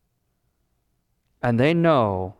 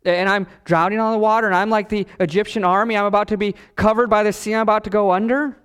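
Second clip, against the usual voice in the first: quick, higher-pitched worried ramble; panicked; 7 semitones higher, faster than usual. Acted. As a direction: rush it out like a sinking man, acted. Voices a disciple panicking while drowning.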